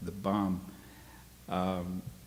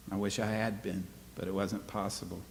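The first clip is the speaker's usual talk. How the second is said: normal talking voice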